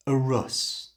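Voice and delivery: "a rus" with two short uh sounds; schwa ending. Shown as a demonstration. Teacher modeling the ending for repetition. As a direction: say "a rus" lightly. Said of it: "Repeat that".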